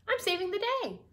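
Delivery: high, energetic storybook declaration; rousing determination. Acted. Miss Tilly busy saving the day, fired up. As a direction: declare each saying in a high, rising rush of resolve. Tilly triumphant while churning.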